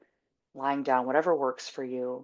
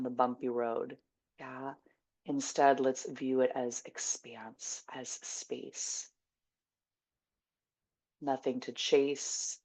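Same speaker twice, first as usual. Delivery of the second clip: guided meditation; 2 semitones lower, 7 dB quieter, slower than usual. Acted. Teacher closing on love as expanse, hushed meditation voice. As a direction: settle them gently, fading almost to a whisper.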